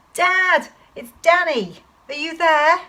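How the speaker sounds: high, loud searching shout; child calling out for dad across a wood. Acted. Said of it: "I shouted".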